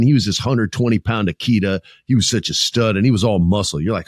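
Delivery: ordinary delivery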